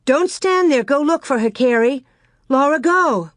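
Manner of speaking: high, loud urgent orders; worried mother ordering the girls. A narrator's reading. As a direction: hurry them off, alarmed. Ma sends girls to search.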